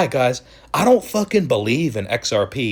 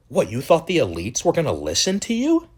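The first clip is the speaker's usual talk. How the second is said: ordinary delivery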